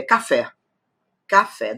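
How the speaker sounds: Café with the final fé strong; stress on last syllable. Shown as a demonstration. Teacher showing an oxytone, Café stressed on fé. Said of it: "Claro que é o fé".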